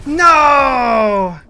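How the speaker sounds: loud, high despairing shout; anguished cry. Real, not acted. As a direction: cry out in defeat.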